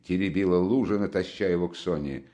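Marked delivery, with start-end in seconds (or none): none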